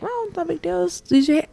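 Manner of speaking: high, soft, dismissive little voice; mock breezy confidence. Student mocking her expectations of sign language, airy high voice. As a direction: toss it off lightly, high and small.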